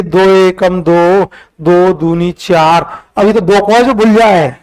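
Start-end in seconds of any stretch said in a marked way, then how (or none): 0.0-3.0 drawn-out times-table chant, each word stretched; slow recitation. Shown as a demonstration. Teacher showing students how tables sound said slowly, slow drawn-out demo. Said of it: "धीरे".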